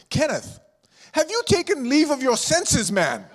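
hammed-up British scolding; British father voice. Preacher quoting his late British father's scolding, mock British accent. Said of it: "the voice of my British father".

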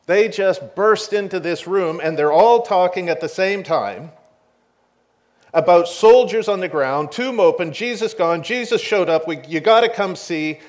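women bursting in with frantic news; breathless excitement. Retold or imitated. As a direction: rush the news out all at once.

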